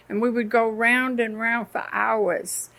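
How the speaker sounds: ordinary talk, nothing marked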